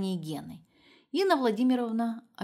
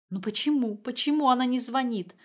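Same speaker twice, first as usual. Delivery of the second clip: anguished cry; 5 semitones higher, 3 dB louder than usual. A narrator's reading. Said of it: "опять воскликнула Инна Владимировна".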